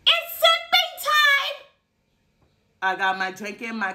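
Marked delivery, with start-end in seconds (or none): 0.1-1.7 very high, slow sing-song; sing-song announcement. Acted. Telling viewers it is drink time, high sing-song. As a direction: announce it like a jingle.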